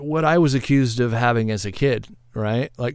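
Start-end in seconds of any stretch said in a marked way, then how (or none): none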